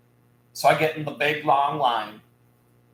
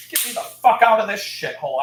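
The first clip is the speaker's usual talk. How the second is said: a touch louder, snapping curse; retold angry exit; 6 semitones higher, 6 dB louder than usual. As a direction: storm off in disgust. Retold storming out of store.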